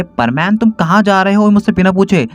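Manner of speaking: high, loud, indignant call; angry Paco. Acted. Paco angrily calling after Pamen, high and loud. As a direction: demand where he thinks he's going. बहुत ज़्यादा गुस्से में.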